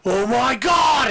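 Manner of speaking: loud, high, drawn-out fan shock; stretched, shouted disbelief; amazed double take. Retold or imitated. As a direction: imitate a stunned fan crying out slowly. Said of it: "that classic double take thing".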